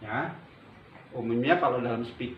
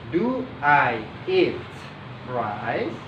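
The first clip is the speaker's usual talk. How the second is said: slow question, RICE rising at the end; intonasi bertanya; much slower than usual. Shown as a demonstration. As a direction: spell it out slowly, lift "rice?" as a question. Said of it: "intonasinya seperti intonasi bertanya". (nada bertanya, pelan, "rice?" naik)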